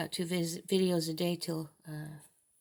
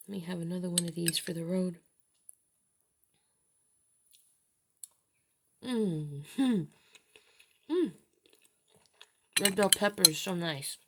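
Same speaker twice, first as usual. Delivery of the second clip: talking through a mouthful of food; mouth full. Real, not acted. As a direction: say it while chewing a bite. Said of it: "Sorry, I'm talking with my mouth full".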